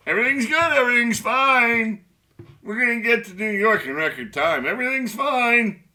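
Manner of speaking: sing-song denial as the ship sails on; mock cheery captain. Mock captain before the iceberg.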